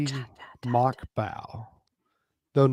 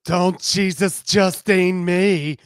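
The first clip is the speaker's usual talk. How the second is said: higher, louder, slow retort; Justine voice; 7 semitones higher, 8 dB louder, slower than usual. Acted. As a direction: snap back playfully. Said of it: "Justine cut in".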